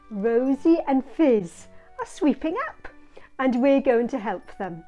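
ordinary delivery

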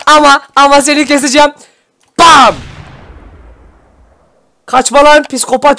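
high, loud hyped yelling; heated gamer hype; excited shouting. Real, not acted. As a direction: chase them down yelling. Heated chase of enemy.

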